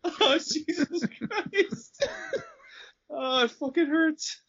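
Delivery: wheezing, high-pitched breathless laughter; laughing so hard it hurts. Real, not acted.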